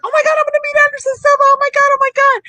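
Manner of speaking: high, rushed fangirl squeal; hyped-up high-pitched excitement; super hyped. Retold or imitated. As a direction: gush it at top pitch, breathlessly excited. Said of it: "I'm, like, super hyped".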